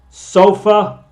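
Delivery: teacher modelling schwa in sofa; schwa demo. Shown as a demonstration. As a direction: stretch out sofa so its vowels ring as schwa. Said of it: "El sonido aquí tiene, es un sonido muy especial".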